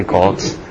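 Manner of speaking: "goats" dropped low, like "golts"; accented echo. Shown as a demonstration. Preacher puzzling over his own word, low accented "goats". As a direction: repeat "goats" with the stray l, pitched well down. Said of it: "Getting an accent".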